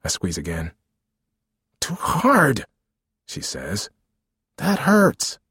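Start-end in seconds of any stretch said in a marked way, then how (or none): 1.8-2.7 high-pitched protest of pain; pained complaint. Acted. Mother to son squeezing her bleeding nose, pained complaint. 4.6-5.4 high, hurt protest; pained complaint. Acted. Mother to son pressing her nose, pained complaint.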